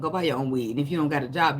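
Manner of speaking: usual speaking voice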